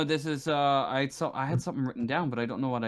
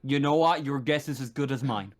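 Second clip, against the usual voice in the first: forceful, impatient officer with punched key words; stern, fed-up marshal; 4 semitones higher, 4 dB louder than usual. Acted. Marshal handing problem to visitors, sternly fed up. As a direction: lay down terms, fed up.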